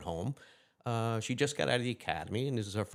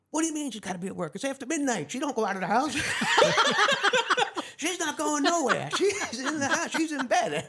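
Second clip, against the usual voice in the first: put-on high, incredulous old father, half laughing; indignant father; 6 dB louder than usual. Retelling the father who says his daughter can't leave the house, incredulous and laughing. Father answering police at door.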